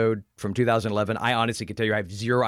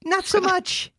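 sarcastic mock; 6 semitones higher than usual. Mocking Eli Roth's possessive credit.

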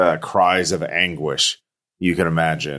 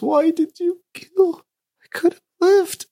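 put-on a dead child asking why; ghost child. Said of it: "you hear a child's voice".

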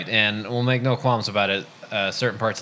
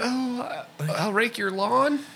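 put-on high, slow old lady voice; little old lady voice; slower than usual. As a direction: fret like a sweet old lady. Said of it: "they get that little old lady voice".

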